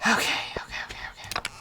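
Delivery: voiceless rapid okays; whispered self-calming. Real, not acted. Frustrated gamer calming herself down, whispered. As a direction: whisper to steady yourself.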